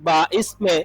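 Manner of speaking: slow, measured reading of a question and answer; reading a lesson text. Acted. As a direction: read it out deliberately for students to follow. Reads question and answer aloud.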